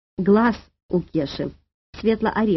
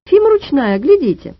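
high, loud indignant protest; getting cross; 6 semitones higher, 6 dB louder than usual. A narrator's reading. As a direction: stand up for the pet crossly. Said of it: "рассердилась Маня". (сердито, с возмущением)

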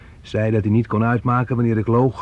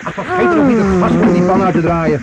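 much louder and higher urgent warning; urgent call; 7 semitones higher, 7 dB louder than usual. Acted. Son to mother after her burn, loud urgent warning. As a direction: call out quickly over the accident. Son calls out about the gas.

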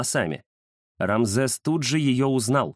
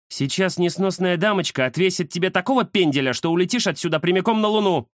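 mild threat, pitched high; threatening; 8 semitones higher than usual. A narrator's reading. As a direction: warn him off as an angry she-cat. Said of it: "пригрозила Индира". (угроза сердитой кошки, высокий голос)

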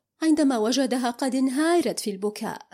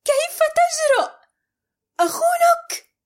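very high, loud, outraged; shocked cry; 6 dB louder than usual. A narrator's reading. Amal screaming in shock at his accusation. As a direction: scream in disbelief. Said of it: "صاحت مصدومة".

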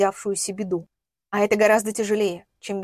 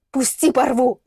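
raised, strained furious warning; angry threat; 3 semitones higher, 4 dB louder than usual. Acted. Woman warns attacker to let go, angry raised voice. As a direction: lash out to break free. Struggling free, threatens him.

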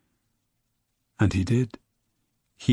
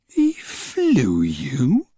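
slow, disbelieving question; can hardly believe it; astonished; 3 semitones higher, much slower than usual. A narrator's reading. Grandma disbelieving.

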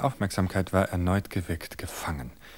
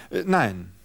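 high, halting wife's voice; hesitant confession; 8 semitones higher than usual. Acted. Wife hinting at a crime to her husband, high and halting. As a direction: fumble for words, higher.

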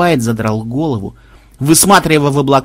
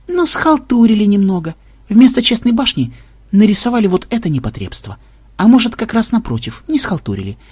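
mostly high-pitched, musing character voice; programmer, wryly pensive explanation; 6 semitones higher than usual. A narrator's reading. Programmer explains designers' shortcuts, wryly pensive. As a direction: reason it out aloud in a raised voice.

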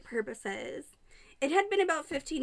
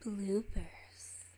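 ordinary talk

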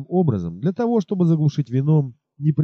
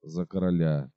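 lower, softer mumbled toast; muttered toast; 9 semitones lower, 7 dB quieter than usual. Acted. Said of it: "пробормотал". (тихо бормоча)

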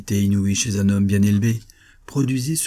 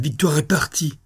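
agitated cry, pitched high; acted high-pitched exclamation; exclaimed outcry; 7 semitones higher than usual. A narrator's reading. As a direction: cry out the news in dismay. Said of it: "s'écria-t-il".

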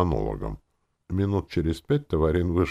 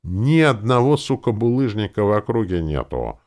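loud, high exasperated complaint; swearing in loud frustration; angry barking; 8 semitones higher, 7 dB louder than usual. A narrator's reading. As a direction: hammer it out angrily. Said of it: "гаркнул Таварин".

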